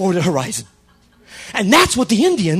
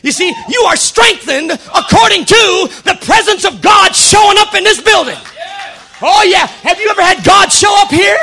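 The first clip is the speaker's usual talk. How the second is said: loud, high revival shout with stretched words; full-force preaching; 10 semitones higher, 10 dB louder than usual. Real, not acted. Preacher praising God before the church, exultant shout. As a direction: proclaim it in triumph, each phrase hurled out.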